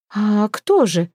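mildly wary, drawn-out question. A narrator's reading. Girl feigning fear to fool the man, mildly wary. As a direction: answer cautiously, stretching the words. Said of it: "опасливо отозвалась Хёрдис".

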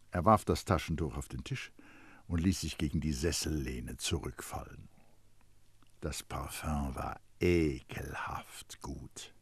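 low and soft, sinking into near-whisper; subdued, low storyteller with long pauses; grudging, deflated narration. A narrator's reading. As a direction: narrate a reluctant admission under the breath.

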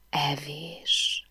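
soft, dreamy exhaled word; breathed softly. A narrator's reading. Narrator echoes his dinner suggestion, softly breathed.

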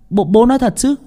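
put-on excited boy. Excited boy asks father.

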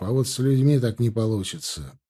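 ordinary delivery

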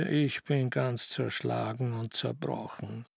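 a soft, slow moan of exhaustion. Acted. Aunt Juliana kisses him, he lies down and moans. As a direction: groan it out, worn to pieces. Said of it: "stöhnte".

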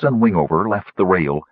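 plain reading voice

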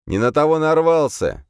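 high, loud challenge; defiant shout. A narrator's reading. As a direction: hurl it back at the demon. Hero defies demon.

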